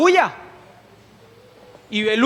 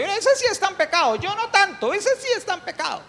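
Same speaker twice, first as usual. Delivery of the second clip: lightly mocking, higher, a holier-than-thou sneer; smug self-righteous voice; 8 semitones higher, 3 dB quieter than usual. Preacher mocking self-righteous churchgoers, in a smug voice. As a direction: mimic someone pointing fingers, lightly. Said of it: "Como uno dice".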